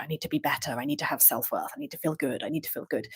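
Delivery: low, soft inner voice. Retold or imitated. Speaker voices her own driven inner voice, softly. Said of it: "So I'm like".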